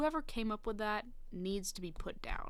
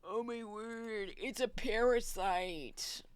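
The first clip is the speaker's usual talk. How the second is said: mock dismay; slower than usual. Mock dismay at his own mistake.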